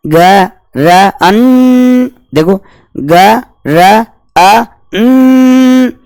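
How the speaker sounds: ग्रंथि broken into ग, र, अन with a long nasal अन; syllable-by-syllable demo. Shown as a demonstration. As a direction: say ग्रंथि slowly piece by piece. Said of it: "अब चर्चा करके देख लो". (ग्रंथि को ग, र, अन टुकड़ों में बोलना)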